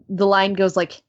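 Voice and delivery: usual speaking voice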